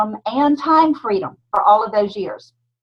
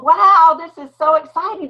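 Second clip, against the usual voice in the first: excited wow; 7 semitones higher, 4 dB louder than usual. Real, not acted. Excited greeting opening her talk.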